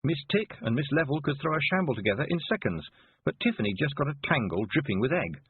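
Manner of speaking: normal talking voice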